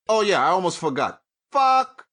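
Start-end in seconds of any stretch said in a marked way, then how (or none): 1.5-2.1 shouted curse. Real, not acted. Ranting at corporations, sudden outburst.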